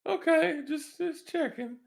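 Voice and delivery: very high, slow put-on voice; high, cartoonish little voice; in-character reply. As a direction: back off in a squeaky falsetto. Same character answers Crystal.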